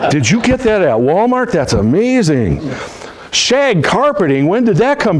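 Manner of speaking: slow, gasping, over-the-top praise of the furniture; gushing fake flattery. Salesman doing the mock warm-up on a client's furniture, gushing. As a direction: gush mockingly over the sofa and carpet.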